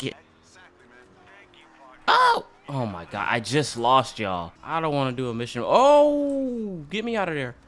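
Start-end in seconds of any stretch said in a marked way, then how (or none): 2.1-2.5 very high, loud "oh" yelp; startled yelp. Real, not acted. Gamer caught by a sudden blast, startled yelp. 5.6-6.8 long, high, loud "oh"; alarmed howl. Real, not acted. Gamer trying to get away, long alarmed howl. Trouble in game.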